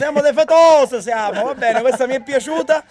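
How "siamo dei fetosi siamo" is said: imitating a Sicilian accent, higher, louder; mock Sicilian echo. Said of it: "Volevo io che siete dei fetusi".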